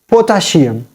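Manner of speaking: "potassium" with sh for the double s; "potassium" said like "potashium"; mispronounced potassium. Shown as a demonstration. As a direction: say "potassium" as po-ta-shee-um. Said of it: "it is not potassium".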